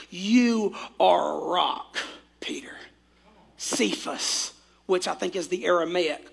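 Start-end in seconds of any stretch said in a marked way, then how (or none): 0.1-4.5 hushed. Retold or imitated. Jesus' tender naming.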